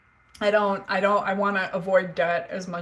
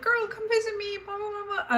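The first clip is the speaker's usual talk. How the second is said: put-on much higher, breezy valley girl; glamorous friend voice; 12 semitones higher, 4 dB quieter than usual. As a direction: gush like a glamorous LA model. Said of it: "she's always like".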